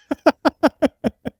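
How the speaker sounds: sudden high-pitched laugh; real amused laugh. Real, not acted. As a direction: burst into a squeaky laugh. Host laughs after story.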